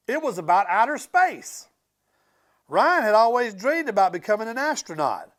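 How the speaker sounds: ordinary delivery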